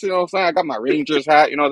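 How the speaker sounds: ordinary delivery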